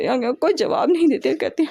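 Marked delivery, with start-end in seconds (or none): none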